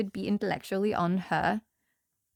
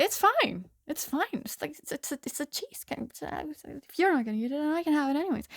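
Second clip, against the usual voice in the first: put-on higher, soft voice of a kid wheedling; pleading son; 6 semitones higher, 3 dB quieter than usual. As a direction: imitate a son brushing off mom's objection, trailing softer. Son pleads with mom for cheese.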